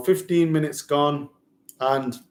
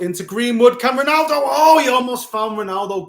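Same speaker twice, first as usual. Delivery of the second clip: much higher and louder, rising hope on the chance; excited near miss; 8 semitones higher, 7 dB louder than usual. Real, not acted. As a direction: build up as the chance nearly lands.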